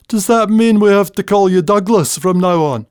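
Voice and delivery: punchline quip. Acted. Willie teasing his friend Dougie in the pub, high and cheeky. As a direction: deliver the joke's punchline brightly.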